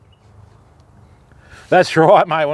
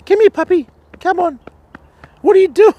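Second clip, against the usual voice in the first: high, bright coaxing call to the puppy; high dog-talk voice; 6 dB louder than usual. Real, not acted. Fisherman calling the farm dogs over, high coaxing voice. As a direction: coax the dog over in a sing-song voice.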